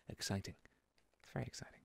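very soft, low near-whisper; mock excitement murmured under the breath; hushed understatement. Player telling viewers mining is exciting, in a hushed voice.

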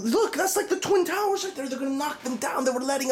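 conspiracy nut spiraling into a high-pitched frenzy; frantic hysteria. Acted. Ranting conspiracy theorist spirals into hysteria.

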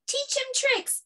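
put-on high-pitched child's demand; eager children. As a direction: pipe up like excited kids. Children call out a request.

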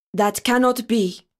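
measured, grave refusal; solemn. A narrator's reading. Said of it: "said Rabbi Lion solemnly".

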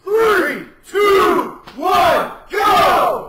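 loud countdown shout. Real, not acted. Countdown to a drinking race.

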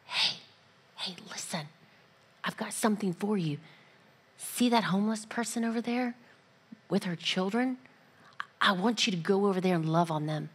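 hushed, soft voice of God; half-whispered, gentle urging; whispered nudge from God; still small voice. Retold or imitated. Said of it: "it's a still, small voice".

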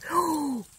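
high gasp of surprise; sharp excited gasp. Real, not acted. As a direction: gasp at a big find. Mushroom turns out huge.

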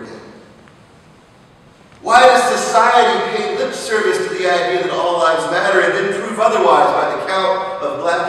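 loud, high, rising sermon cry; preacher crying out to God over slain children, fiery; fiery preaching. Acted. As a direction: lift the congregation with each why. Preaching at full force.

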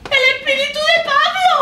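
high terrified cry; terrified shriek. Acted. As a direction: scream at the ghost. Landlady terrified of the ghost.

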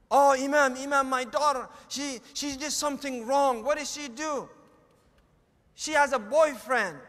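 loud, hammed-up alarm, tripping over words; mock panicked appeal; acted distraught parent. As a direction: play a parent pleading for the imam's help.

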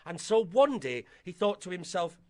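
ordinary delivery, nothing marked

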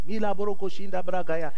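fluent prayer in tongues; speaking in tongues. Shown as a demonstration. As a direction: pray in tongues, unbroken flow. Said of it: "thank God for tongues".

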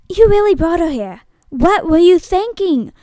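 heated, high-pitched scolding; angry confrontation. Acted. Audition, angry confrontation over a traitor.

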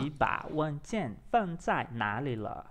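slow, careful Mandarin; practice repetition. Shown as a demonstration. Learner practising along with app, slow and careful. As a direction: repeat the model word by word. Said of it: "eu vou praticar aqui".

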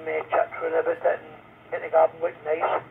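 ordinary talk, nothing marked

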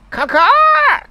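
high shrill-free loud caw-caw; crow caw. Pretend. Streamer reading chat's bird call as a caw. Chat owl impression read out.

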